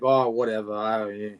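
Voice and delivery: lightly imitated New York accent, low and tossed off; a hint of New York cop. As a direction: mimic a cop's New York accent a little, dropping in pitch. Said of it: "he had this strong accent".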